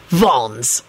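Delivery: low, curt hiss. A narrator's reading. Sirius names his weapon to Baldy, hissed. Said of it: "Sirius hissed".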